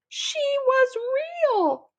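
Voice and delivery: high, loud desperate cry; screaming. A narrator's reading. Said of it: "I scream".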